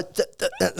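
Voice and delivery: th- th- th- stammered out; stuttering. Shown as a demonstration. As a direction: demo a stuck stutter on th. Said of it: "start really stuttering".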